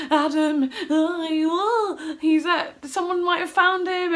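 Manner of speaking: loud, high, wailing excitement; re-enacted frantic near-tearful phone call; crazed mess. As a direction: blurt it out hysterically, high and loud. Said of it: "this crazed mess at this point".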